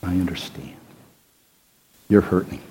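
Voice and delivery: sit beside a hurting friend and soothe; low hushed comfort to a grieving friend; comforting someone. Retold or imitated. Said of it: "you sit down and say".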